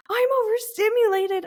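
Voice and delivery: high, whiny overwhelmed cry; freaking out. Retold or imitated. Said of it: "I'm freaking out at her".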